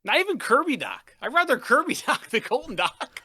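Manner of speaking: loud, high-pitched disbelief; exasperated fan raising the voice way up; incredulous outburst. Real, not acted. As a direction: cry out in comic outrage. Outraged disbelief at a trade.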